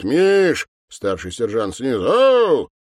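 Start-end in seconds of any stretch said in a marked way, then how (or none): 0.0-0.7 loud, stretched, raised call. A narrator's reading. Captain calling his colleague, shouting. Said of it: "кричит". 2.0-2.7 long, high, loud holler; shouted reply. Acted. Senior sergeant answering from downstairs, calling out.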